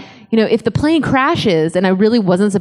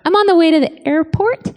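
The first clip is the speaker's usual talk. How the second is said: sheepish confession; 7 semitones higher than usual. Retold or imitated. Sheepish confession to husband on phone.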